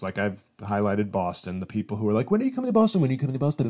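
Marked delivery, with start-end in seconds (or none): 2.3-3.0 high, whiny imitation of a nagging fan; mock pushy fan. Comedian mocking Boston fans who nag online, in a high whiny voice. 3.0-3.7 a milder repeat of the whiny fan voice; mock pushy fan, repeated. Comedian repeating the nagging Boston fan, closer to his own voice.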